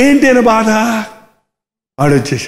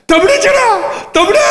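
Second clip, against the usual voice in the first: excited announcement; 14 semitones higher than usual. Acted. Servant announcing brother's return.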